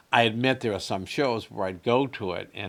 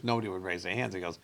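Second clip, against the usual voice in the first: ordinary talk, nothing marked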